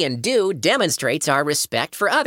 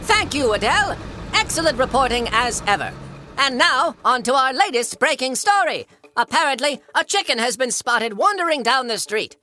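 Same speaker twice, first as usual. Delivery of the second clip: higher, formal newsreader delivery; news anchor voice; 10 semitones higher than usual. Acted. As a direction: announce it like a TV anchor. Anchor teases next story.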